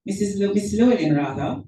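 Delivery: normal talking voice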